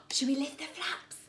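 ordinary delivery

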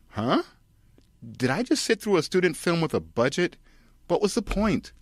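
rising to very high confused whine; baffled audience. Acted. Audience puzzled after the credits, high baffled voice. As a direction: sound utterly lost.

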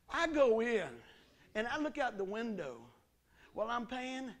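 normal talking voice

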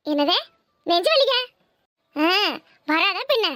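scornful disbelief. Acted. Mother-in-law mocks the news.

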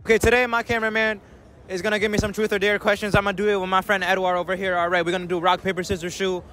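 usual speaking voice

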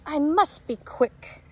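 Little Chick's voice. A narrator's reading. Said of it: "says Little Chick".

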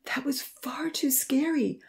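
slow scared whisper; frightened whisper. A narrator's reading. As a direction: breathe it out, still spooked by the fire. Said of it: "she shuddered".

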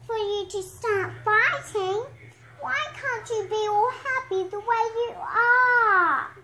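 high little piglet voice; squeaky voice. Acted. Little hog pleading with the animals, squeaky. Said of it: "in a squeaky voice".

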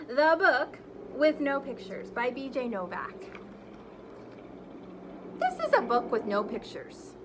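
lively, animated read-aloud; reading to children. Acted. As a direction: read it big for the kids. Teacher reads the book aloud expressively.

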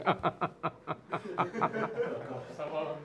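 a long, soft, sincere burst of laughter; hearty genuine laugh. Retold or imitated. Showing the young man a sincere laugh. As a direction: laugh honestly, as if the question amused you. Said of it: "Bir daha samimi güldüm ha".

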